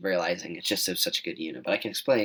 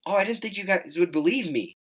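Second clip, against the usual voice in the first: cousin's excuse; 10 semitones higher than usual. Retold or imitated.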